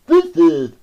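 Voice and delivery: very loud villain with a high cackle; gleeful cry. A narrator's reading. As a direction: relish it, then burst into laughter. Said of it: "he cried with obvious glee".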